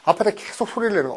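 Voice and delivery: ordinary talk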